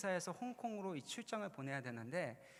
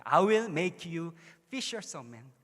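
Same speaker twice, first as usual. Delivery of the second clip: very slow and much louder, booming "will"; proclaimed quote; 11 dB louder, much slower than usual. Retold or imitated. Pastor proclaiming the verse to the congregation, booming slowly. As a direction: proclaim "I will make you fishers of men" like a declaration. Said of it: "그 영어로 계속 얘기하는 거야".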